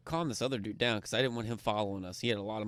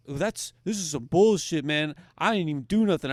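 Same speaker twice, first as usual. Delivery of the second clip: higher and louder, indignant whine; whiny loud complaint; 6 semitones higher, 10 dB louder than usual. Retold or imitated. Kicked-out player protests his innocence, loud indignant whine. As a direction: sulk and protest like a wronged man.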